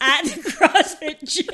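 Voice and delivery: words broken by laughter; giggling at the CrossFit gym; laughing. Real, not acted. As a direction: laugh through it. Laughing through the story.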